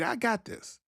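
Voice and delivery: soft dreamy. Acted. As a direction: say it softly like a dream come true. Soft dreamy imagined friend.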